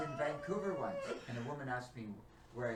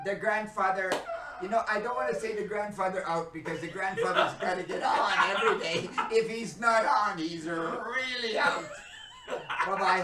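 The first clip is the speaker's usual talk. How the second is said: loud, high words through laughter; laughing; 6 semitones higher, 15 dB louder than usual. Real, not acted. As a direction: sign off while still cracking up. Laughing sign-off.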